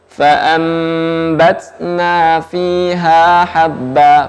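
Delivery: slow, chanted Quranic recitation. Shown as a demonstration. Teacher models the verse for students, slow chant. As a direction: recite it slowly, each letter placed.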